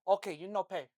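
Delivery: deadpan imitation in a light Brazilian accent; mock Brazilian accent. Manager answering friend's joke, mock Brazilian accent. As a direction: agree matter-of-factly in a lightly put-on Brazilian accent. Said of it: "With a stern face".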